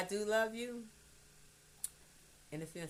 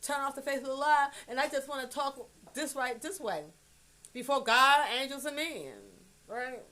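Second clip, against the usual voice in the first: a touch louder, slowed defiance; sassy comeback; 5 semitones higher, 5 dB louder than usual. Retold or imitated. Mother telling off a nosy Facebook commenter, sassy. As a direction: tell the critic off with attitude. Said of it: "I'd be like".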